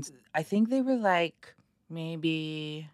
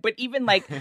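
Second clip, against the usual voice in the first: normal talking voice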